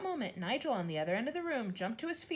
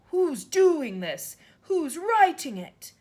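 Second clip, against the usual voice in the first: slow, much louder, higher yell; 10 semitones higher, 13 dB louder, much slower than usual. A narrator's reading. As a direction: shout at the empty room. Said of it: "she yelled at nobody".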